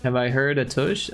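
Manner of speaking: ordinary delivery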